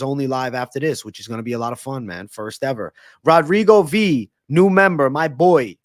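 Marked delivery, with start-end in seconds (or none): 3.2-5.8 loud, raised announcer-style welcome; hyped shout-out. Real, not acted. Streamer welcoming a new member, hyped shout-out. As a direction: call the name out loud and proud.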